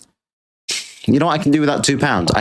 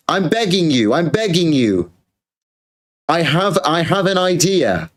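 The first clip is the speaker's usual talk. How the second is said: higher, hammed-up begging; mock begging; 6 semitones higher than usual. Streamer begs Xi Jinping for a pandemic, mock desperate begging. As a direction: plead as if on your knees.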